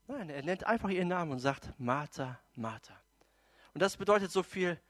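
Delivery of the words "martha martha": low, soft naming; relaxed calming. Acted. Jesus answering agitated Martha, relaxed and calm. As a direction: say the name low, to settle someone down. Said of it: "Jesus ist ganz entspannt".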